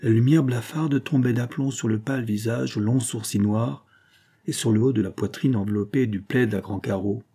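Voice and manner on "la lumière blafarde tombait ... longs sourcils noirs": plain reading voice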